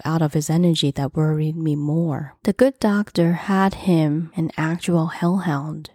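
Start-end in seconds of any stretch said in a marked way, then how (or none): none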